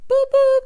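high, loud spoken car horn; mock horn honk. Quoting a reality star to recap viewers, loud horn imitation.

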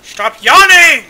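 loud, high, slow protest; annoyed yell. Real, not acted. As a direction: yell at your friend to quit it. Friend keeps yawning on purpose.

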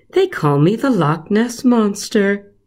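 lightly voiced proud monster; a touch of the snake. Snake revealing himself to Patrick, light creature voice. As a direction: announce your name with sly pride.

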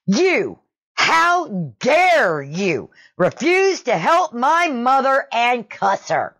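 high, drawn-out outraged mock scream; retold scream. Son confronting the shopper over his mother, mock screaming. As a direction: imitate an irate son, slow and high. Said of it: "and screams at me".

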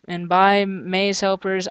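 normal talking voice

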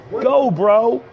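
ordinary talk, nothing marked

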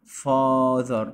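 "father" with a drawn-out open AH; long AH. Shown as a demonstration. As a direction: stretch the A in "father" into "aah". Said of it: "الإي بيعمل لي صوت الآ". ("father" بمد صوت الآ)

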